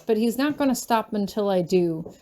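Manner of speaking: ordinary delivery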